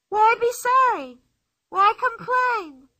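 put-on high, loud, slow playful child voice; Pippi's voice. A narrator's reading. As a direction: cheerfully brush off a friend's tears.

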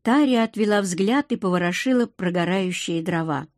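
ordinary delivery, nothing marked

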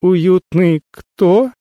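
can't hold back the question; incredulous. A narrator's reading. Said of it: "не выдержав, переспросил я".

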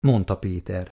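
plain reading voice

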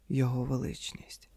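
soft, hushed echo; said softly. A narrator's reading. Wife quietly echoes her husband's words, softly hushed. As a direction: repeat it quietly, almost to herself. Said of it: "тихо промовила Ліз".

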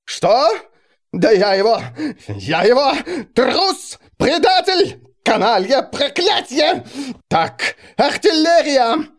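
high, loud furious raging; furious roaring king. Acted. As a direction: roar it like a wild beast. King rages at betrayal.

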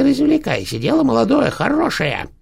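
very high, mocking crone voice; spiteful old hag. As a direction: needle her with fake praise, sing-song. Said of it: "ехидно продолжала старуха".

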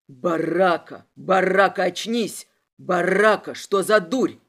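loud, low-pitched urgent calls; low henchman's yell. A narrator's reading. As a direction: call the name forcefully to rouse him. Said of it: "как пронзительно орёт у меня за спиной Заремба".

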